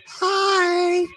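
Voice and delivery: pretend starstruck falsetto. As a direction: coo like a dazzled fan. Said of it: "he's gonna make believe".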